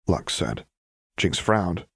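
usual speaking voice

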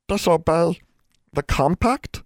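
high, squeaky little elf voice; small creature squeak, far above the narration; frightened elf. A narrator's reading. Nat recoils at breaking the compact, frightened high elf voice.

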